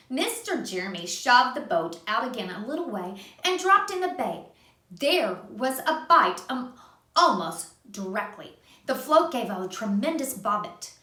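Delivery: put-on gentle read-aloud for a child; reading to a little one; storybook narration. A narrator's reading. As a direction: tell it like a bedtime story. Reading a picture book aloud.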